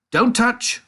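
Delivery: very high loud warning; shouted. A narrator's reading. Wonka shouts at the children, high loud warning. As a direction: stop them with a sharp call. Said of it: "shouted Mister Wonka".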